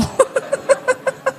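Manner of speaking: a high quiet chuckle; high soft laugh. Real, not acted. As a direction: laugh off the dark joke. Laughs after cyanide joke. (tawa tinggi yang lembut)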